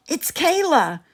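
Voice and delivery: high, loud answering call; call back. A narrator's reading. King calls back to Kayla, high and loud. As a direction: shout back so she hears. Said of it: "I call back".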